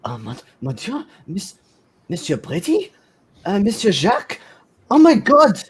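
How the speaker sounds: put-on high-pitched French accent, building to a loud cry; rising astonishment in a thick French accent. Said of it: "saying in a very thick French accent".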